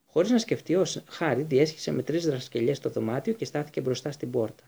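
usual speaking voice

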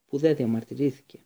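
usual speaking voice

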